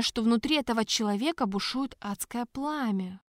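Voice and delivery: plain reading voice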